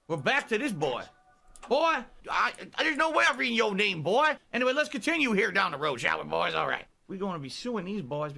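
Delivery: put-on southern accent; Colonel Sanders. Streamer playing a horror game as Colonel Sanders, southern voice. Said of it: "of course I'm still doing an accent".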